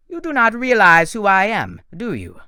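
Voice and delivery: high, loud, gloating question; menacing villain. Acted. Doctor Thorne gloating at Percy, high and loud. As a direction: lean in and gloat.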